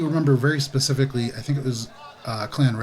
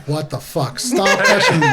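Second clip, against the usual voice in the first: Carranza protesting; 4 semitones higher, 4 dB louder than usual. Acted. Carranza objects to people poking, protesting with rising pitch. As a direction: protest that leaps up high and louder. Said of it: "Carranza's like".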